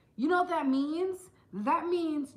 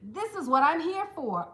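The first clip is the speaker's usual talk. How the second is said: higher, louder happy gush; delighted; 5 semitones higher, 4 dB louder, slower than usual. Retold or imitated. Answering the hygienist who praises her teeth, delighted. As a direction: soak up the compliment with glee. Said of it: "I'd be like".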